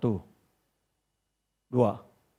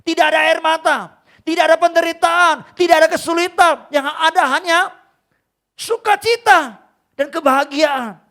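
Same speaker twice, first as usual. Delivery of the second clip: put-on loud, high, booming voice of God speaking to his child; God's voice, loud and raised; 11 semitones higher, 9 dB louder than usual. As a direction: thunder it out as God gently rebuking his son. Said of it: "Tuhan berkata begini".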